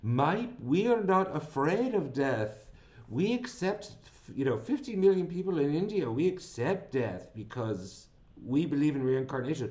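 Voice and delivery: a hint of an Indian accent, half-hearted. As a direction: self-consciously imitate an Indian witness on the stand. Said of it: "I don't think I could do this accent very well".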